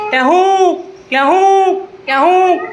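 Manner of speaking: drawn-out two-note peacock cry; peacock call. Shown as a demonstration. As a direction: call like a peacock, stretching the end. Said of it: "તમે મોરનો અવાજ કાઢો". (મોરનો ટહુકો)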